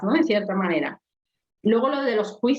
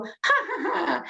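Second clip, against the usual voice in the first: high, forced fake laugh; hammed-up ha ha ha; mock laugh; 9 semitones higher than usual. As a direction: laugh at yourself on purpose. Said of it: "me voy riendo a mí misma".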